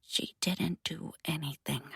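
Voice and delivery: flat, quiet monotone mutter. Acted. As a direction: mutter it dead flat, no tears. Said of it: "mutters it in a monotone voice free from tears".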